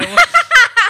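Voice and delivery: high loud laugh. Real, not acted. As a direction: crack up laughing. Laughing at joke.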